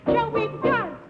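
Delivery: usual speaking voice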